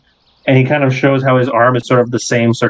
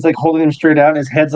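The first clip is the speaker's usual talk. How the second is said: ordinary talk, nothing marked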